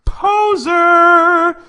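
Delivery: shrieked high, drawn-out jeer; hammed-up, piercing yell; mock scream. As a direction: scream it like a loud, high-pitched accusation. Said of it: "everything in this image screams".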